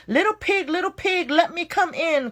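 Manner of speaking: high, coaxing shark voice calling at the door; anxious shark calling. Acted. Hungry shark begging the pigs to open, high and eager. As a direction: call up high, eager to get in. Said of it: "called the magic shark, growing upset".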